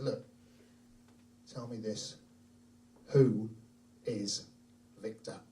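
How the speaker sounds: low, slow-paused stern question; hushed, low, deliberate fatherly demand; grave questioning. Retold or imitated. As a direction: question a child softly, word by word. Father solemnly questions his son.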